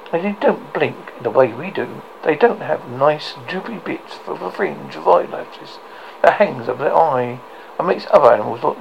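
ordinary delivery

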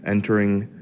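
building. Pretend. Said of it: "entering accent era".

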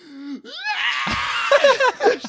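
high, loud shrieking wail half laughed; hammed-up screaming cry; mock hysterical wail. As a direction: shriek like a mother seeing her son die.

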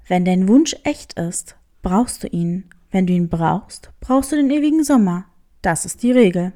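low, sly tomcat questioning the hare; a low, sly cat sizing up a stranger. Acted. Cat explains the rule. (tiefe, listige Katerstimme)

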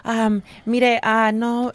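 imitating a nervous, hesitant woman. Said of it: "she was so nervous".

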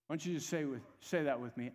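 ordinary delivery

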